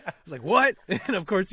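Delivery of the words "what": high, loud, defensive mock yell; mad retort. Mad player answering his wife, indignant mock yell. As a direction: fire it back at top pitch. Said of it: "you're kinda mad".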